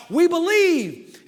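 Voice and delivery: high, drawn-out loud cheer; crowd shout. Acted. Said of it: "And they shout".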